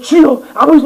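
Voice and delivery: high, pleading call; crying out to God. Retold or imitated. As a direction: call out to the Father. Longing to cry out. (높은 목소리로 주님을 부르짖듯)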